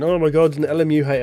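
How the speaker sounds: hammed-up shocked fan; mock outraged fans. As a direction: parody fans overreacting, hammed-up.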